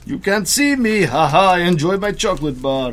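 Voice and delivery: a hint of a bad Russian accent, taunting from hiding. Soldier hiding in the woods taunts the enemy, in a bad Russian accent. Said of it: "Speaking in a bad Russian accent".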